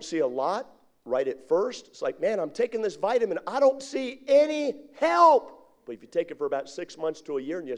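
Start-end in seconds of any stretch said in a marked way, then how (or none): 2.2-5.6 mock whine building high and loud; exasperated complaint. Vitamin taker seeing no result, rising loud complaint. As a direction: imitate impatient griping, stretched at the end.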